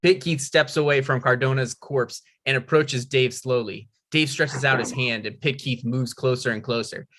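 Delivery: ordinary talk, nothing marked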